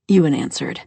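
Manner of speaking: ordinary delivery, nothing marked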